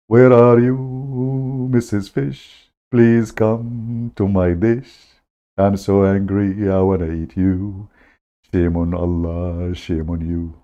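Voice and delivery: hammed-up hungry fisherman calling out; slow, pleading call turning into a sulky complaint; calling the fish.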